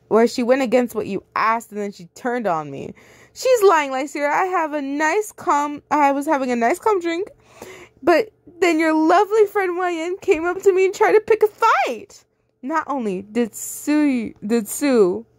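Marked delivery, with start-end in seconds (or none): 3.3-12.2 much higher, sweet fake-innocent voice; playing innocent to fool her friend; whiny liar. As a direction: Sue lying to Lysira, whiny and high. Sue voiced lying to Lysira.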